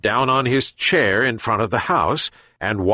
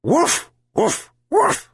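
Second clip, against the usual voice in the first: high, yapping dog barks; dog yelp woof; 13 semitones higher, slower than usual. Acted. Dog yelping to show the old man the pill, yapping barks. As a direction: bark sharply like a little dog. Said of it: "the dog yelped".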